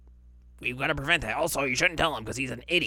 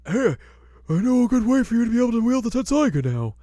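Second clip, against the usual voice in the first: put-on higher, louder, playful old-man voice; old swordsmith voice; 11 semitones higher, 8 dB louder than usual. Totosai pitching a trick to Inuyasha in a raised goofy voice. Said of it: "Totosai is just like".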